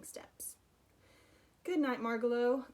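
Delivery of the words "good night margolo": louder, higher goodnight call. Acted. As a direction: call after her as she hops off. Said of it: "called Stuart".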